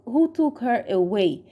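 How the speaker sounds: plain reading voice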